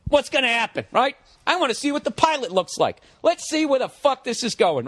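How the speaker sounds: high-pitched, eager inner voice; giddy anticipation. Retold or imitated. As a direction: act out thrilled curiosity. Retold eager thoughts before button press.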